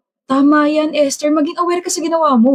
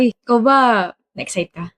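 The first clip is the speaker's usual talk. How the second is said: ordinary talk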